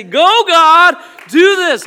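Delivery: high, loud fervent plea; fervent cry. Pretend. Churches praying for Georgia, a high, loud fervent cry. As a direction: cry out to God to act.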